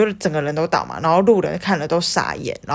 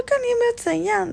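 worried friend. Retold or imitated.

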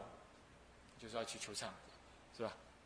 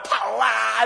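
mock shout; 3 semitones higher, 7 dB louder than usual. Acting running from tiger.

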